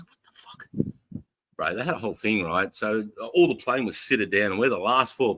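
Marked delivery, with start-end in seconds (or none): 0.0-1.2 slow whispered what the fuck; slow stunned whisper; whispered disbelief. Retold or imitated. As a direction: whisper it in shock. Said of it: "I'm like".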